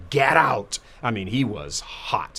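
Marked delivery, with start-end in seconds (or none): 0.1-0.8 retold scream. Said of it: "he just screamed at him".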